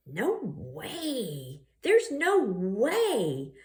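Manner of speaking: drawn-out mocking sneer; mocking refusal. Madison mocks Justin's lunch, slow and sneering. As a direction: stretch the taunt out slowly.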